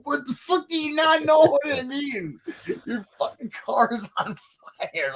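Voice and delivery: high, laughing disbelief; laughing exasperation. Retold or imitated. Band shouting at the clueless driver, laughing and high. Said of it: "It's like".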